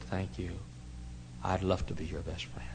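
put-on whispered tenderly to a small child; soft whisper. Preacher whispering softly back to the little girl. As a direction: hush it gently, like to a little girl.

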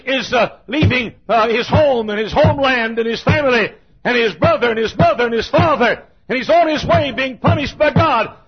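high, loud preaching cadence; fervent preaching. Acted. Preacher pleading with his congregation, loud fervent preaching. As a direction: drive the sermon home with force.

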